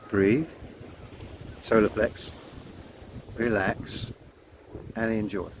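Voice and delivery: calm mantra. Shown as a demonstration. Guiding the breath mantra, softly fading. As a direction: let it fade into calm. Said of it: "the mantra".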